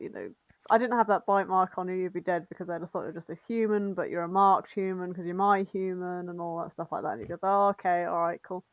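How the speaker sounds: normal talking voice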